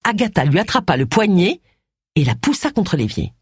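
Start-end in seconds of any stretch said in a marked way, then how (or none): none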